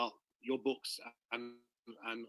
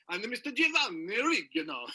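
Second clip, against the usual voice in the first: put-on higher, showy Italian accent on the name Giovanni Rigano; perfect Italian accent; 8 semitones higher, 3 dB louder than usual. Festival host finally nails the Italian guest's name, Italian accent. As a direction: roll out the name like a proud Italian announcer. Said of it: "absolutely perfect Italian accent".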